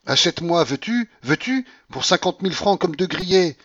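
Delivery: high, loud, desperate outburst; hysterical cry. A narrator's reading. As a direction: cry out as if near sobbing. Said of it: "cria-t-elle d'une voix entrecoupée de sanglots".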